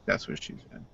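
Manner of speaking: breathy unvoiced whisper. Real, not acted. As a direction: slip the joke in a whisper. Punchline whispered.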